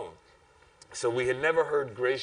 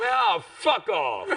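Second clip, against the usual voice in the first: stretched, higher mock snarl; retold brush-off; 9 semitones higher, 4 dB louder, much slower than usual. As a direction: slam it shut with a sneer. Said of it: "the guy slams the door in our face and goes".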